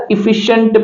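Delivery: एफिशिएंट with the ci read as sh; English pronunciation. Shown as a demonstration. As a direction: say एफिशिएंट as "ih-FISH-unt". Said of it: "इसको पढ़ा जाएगा".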